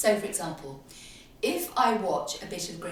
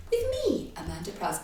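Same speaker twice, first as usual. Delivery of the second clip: mock her own podcast sign-on; sing-song intro. Host mocking how she opens the show, swooping up. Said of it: "I really hate the way I go".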